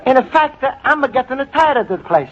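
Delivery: put-on bluffing in a thick Italian accent; proud Italian immigrant, fibbing to his date. Luigi to Shirley, pretending he's a regular, in an Italian accent.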